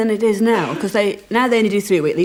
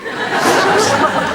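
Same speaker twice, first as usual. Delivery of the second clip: high burst of laughter; giggling high laugh; 12 semitones higher, 4 dB louder than usual. Real, not acted. Laughing at self.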